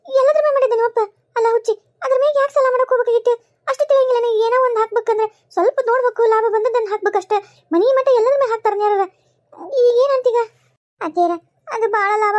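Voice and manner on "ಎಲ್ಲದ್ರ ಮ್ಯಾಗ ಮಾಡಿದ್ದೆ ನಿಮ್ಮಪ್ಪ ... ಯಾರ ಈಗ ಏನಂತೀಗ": loud, high, exasperated reproach; indignant mother-in-law; agitated scolding. Acted. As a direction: berate her for such foolishness. Mother-in-law scolds about reckless loan.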